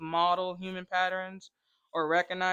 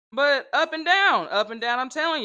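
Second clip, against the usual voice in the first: excited; 5 semitones higher, 8 dB louder than usual. Real, not acted. Excited retelling of pigs.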